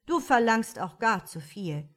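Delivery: loud, grumbling fool; lazy fool voice. A narrator's reading. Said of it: "der Narr sagte".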